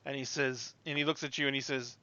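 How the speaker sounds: ordinary talk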